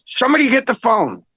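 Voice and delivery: high, quick call across the room. Retold or imitated. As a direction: imitate a producer calling for someone to pick up. Acted quote calling out to the room.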